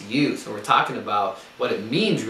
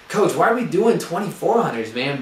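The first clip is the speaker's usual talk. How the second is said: mock complaining runner; 7 semitones higher, 7 dB louder than usual.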